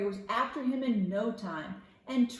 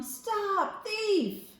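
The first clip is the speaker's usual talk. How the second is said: much higher, shouted "Stop, thief!"; calling out angrily; 11 semitones higher, 3 dB louder than usual. Acted. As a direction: yell after the thief, pitch way up. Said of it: "waving a rake and calling".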